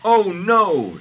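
high, loud drawn wail; hammed-up dying-god cry; screaming. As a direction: scream it in mock horror. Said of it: "screamed Ra".

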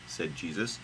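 usual speaking voice

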